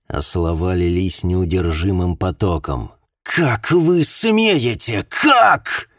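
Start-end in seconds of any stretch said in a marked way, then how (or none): 3.2-5.9 high, slow scream, much louder; voice breaking into a scream. Acted. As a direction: let the voice crack into a scream. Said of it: "Голос миссис Уилкинс сорвался на крик".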